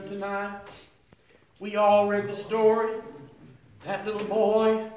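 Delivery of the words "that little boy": ordinary talk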